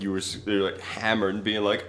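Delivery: ordinary talk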